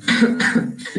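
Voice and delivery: choking cough; short rough cough. Real, not acted.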